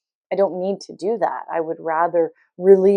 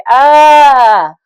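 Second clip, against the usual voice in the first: a loud, higher ah, held back from full force; restrained yell; medium scream; 7 semitones higher, 13 dB louder than usual. Retold or imitated. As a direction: let out a moderate scream. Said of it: "I did, like, a medium scream".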